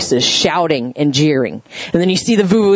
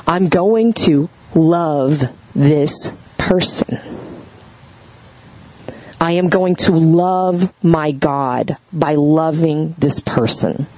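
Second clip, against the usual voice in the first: sincere resolve; 3 semitones lower, slower than usual. Pretend. Voicing a heartfelt inner resolve.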